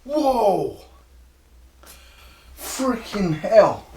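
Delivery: high, drawn-out exclamation; exaggerated high-pitched outburst; put-on impression voice. Attempting impressions of other people.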